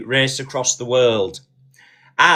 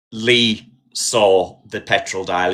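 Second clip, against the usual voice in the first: ordinary talk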